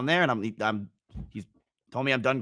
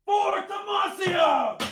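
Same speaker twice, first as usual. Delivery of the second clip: high, slow battle-cry shout; Garen impression; much slower than usual. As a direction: yell it like a warrior charging. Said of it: "my Garen impression".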